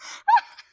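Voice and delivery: a high squeal of a laugh; shocked laugh. Real, not acted. Hearing about the rejected note, high shocked laugh. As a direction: burst out laughing in disbelief.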